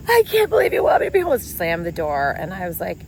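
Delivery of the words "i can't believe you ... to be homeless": high, loud, fast whining; mock the teen's wounded whine; hammed-up whining stepson, pitched high and loud; whiny stepson.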